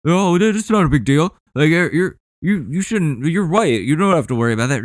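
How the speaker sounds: mocking dismissive relative voice. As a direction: mimic family brushing it off. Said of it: "wanna act like it's not a thing".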